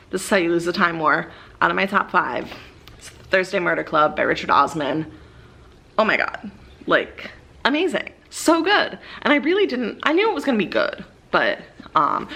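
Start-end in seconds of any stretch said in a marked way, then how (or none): 6.0-11.0 high, bright rush of excitement about a loved book; high-pitched fangirl enthusiasm; excited gushing. Real, not acted. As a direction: gush over it like you can't contain yourself.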